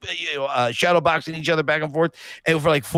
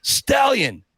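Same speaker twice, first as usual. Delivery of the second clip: mock showman's taunt, pitched up at the start; Apollo Creed; 3 semitones higher, slower than usual. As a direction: call out a challenge like the movie rival. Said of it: "he turns into Apollo Creed".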